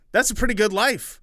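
put-on high eager boy voice; chirpy little boy; kid voice.

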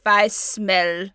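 mock Russian accent, slow and loud; male Russian Baba Yaga. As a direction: attempt a man's Russian accent. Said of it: "I can only do it male".

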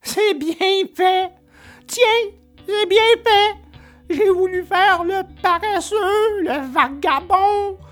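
put-on high-pitched, wailing little boy voice; acted crying Pinocchio; tearful puppet lament. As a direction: lament in a small high voice, full of self-reproach. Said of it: "il disait en pleurant".

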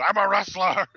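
loud, high wrestler bellow; mock wrestler shout. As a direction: belt it out like a hammed-up wrestler. Wrestler's repeated answer.

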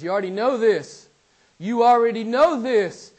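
loud, rising insistence; fervent repetition; emphatic quote. Acted. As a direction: press it on the hearers. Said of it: "he says".

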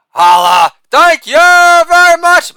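Holla barked loud, then thank you very much stretched slow and very high; shouted catchphrase. Acted. Comic to audience, hammed-up sign-off, loud high drawn-out shout. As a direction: belt the sign-off like a showman milking applause.

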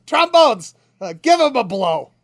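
put-on old music teacher calling the section out loud. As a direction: announce it big, then hand it over.

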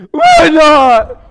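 high, loud whining outburst; whiny preteen protest. Retold or imitated. Voicing a daughter protesting to her mom. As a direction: wail the question like a teen. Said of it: "daughter protests".

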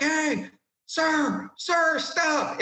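high, strained yell at an officer; retold scream. Soldier yelling at his captain to stop. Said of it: "I'm screaming at Captain Dominiani".

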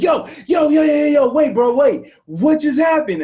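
high, loud excited shouting; freaking out. Retold or imitated. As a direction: yell it like you just saw a miracle. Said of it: "And I was freaking out".

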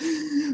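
sharp high gasp of alarm; startled gasp. Shown as a demonstration. Showing the panicked reaction to a problem, sharp gasp. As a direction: suck in air in fright. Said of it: "instead of being like".

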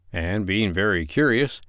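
ordinary delivery, nothing marked

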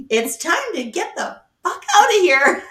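high, loud, laughing Mickey Mouse impression. Son doing Mickey, telling the family to leave, high and loud. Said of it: "he has a Mickey voice".

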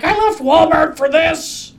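scared greeter. Retold or imitated. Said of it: "greeters who were like".

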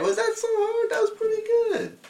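squeaky high teasing voice; mock falsetto praise. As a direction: coo it in a silly high voice. Teasing friend after the call.

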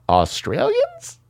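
high, drawn-out mock question; a high mocking "Australians?"; mock Australian squeak. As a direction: squeak the word like a bad impression. Mocking Coburn's fake accent.

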